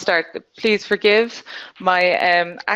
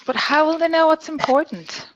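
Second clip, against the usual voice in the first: exaggerated acting; 9 semitones higher than usual. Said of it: "I might exaggerate slightly".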